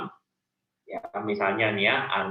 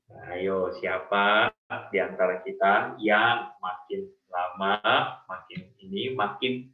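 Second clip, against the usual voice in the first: ordinary talk, nothing marked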